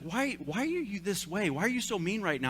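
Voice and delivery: a lightly voiced wife asking why he's mean; wife's hurt question. Wife to moody husband, a hint of hurt pleading.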